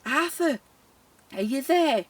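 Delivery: high-pitched call to Arthur upstairs. Retold or imitated. Care worker calls to dead resident upstairs, mock-plaintive calling out. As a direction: call out playfully to someone who can't answer. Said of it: "So I called out".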